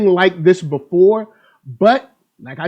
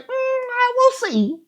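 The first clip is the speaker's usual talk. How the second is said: skeptical high voice; 5 dB quieter, slower than usual. Retold or imitated. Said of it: "I was like".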